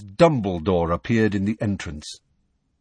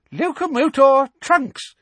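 much higher, louder, slow squeaky incantation; squeaked spell; 5 dB louder than usual. Acted. Flitwick levitating the luggage, high squeaky spell. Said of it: "He squeaked".

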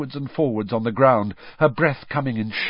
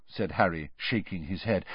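usual speaking voice

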